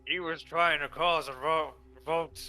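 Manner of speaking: loud, high, fast angry shout. Acted. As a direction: yell it out furiously. DM voices angry guard.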